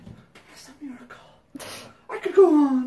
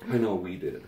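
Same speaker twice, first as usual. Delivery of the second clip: ordinary talk, nothing marked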